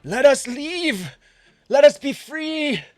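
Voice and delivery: high, loud, drawn-out plea; pleading shout. Acted. Game master voices a character calling out for release, shouting. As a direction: shout it out high, begging for freedom. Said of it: "he kinda stands up and he shouts".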